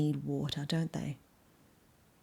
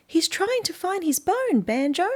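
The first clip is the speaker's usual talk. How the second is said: much higher and louder tiny bug; lady beetle voice; 11 semitones higher, 5 dB louder than usual. Acted. Lady beetle explaining Rusty to Banjo, high and bright. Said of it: "lady beetle answers".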